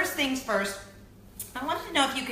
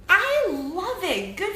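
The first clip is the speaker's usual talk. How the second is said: high, slow, delighted gush; 9 semitones higher, 5 dB louder, much slower than usual. Real, not acted. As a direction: swoon over the dolls. Gushing over man bun doll.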